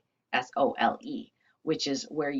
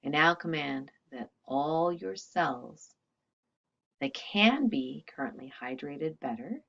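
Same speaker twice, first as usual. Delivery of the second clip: healing invocation; 5 dB quieter than usual. Acted. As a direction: intone each command with calm authority. Speaks healing commands, fading softer.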